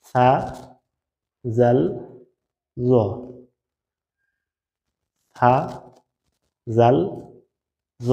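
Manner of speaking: সা জাল জ said slowly, tongue tip on the upper front teeth; spaced-out recitation of সা, জাল, জ; letter pronunciation demo. Shown as a demonstration. Teacher modelling Arabic letters for students, slow and careful. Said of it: "তিনটা হরফ কি কি?".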